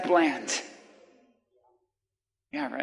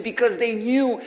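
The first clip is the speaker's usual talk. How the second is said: normal talking voice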